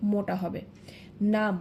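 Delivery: ordinary delivery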